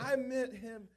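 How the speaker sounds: high, loud laughing defence; laughing protest. Real, not acted. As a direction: protest loudly through real laughter. Laughing self-defence after being caught.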